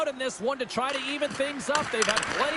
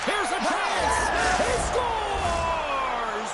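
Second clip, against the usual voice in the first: excited goal call; 3 semitones higher, much slower than usual. Real, not acted.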